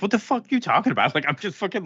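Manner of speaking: indignant Miriam. Retold or imitated. Tied-up Miriam snaps back at the cops, fast and indignant. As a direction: snap back fast, like the idea is absurd. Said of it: "She's like".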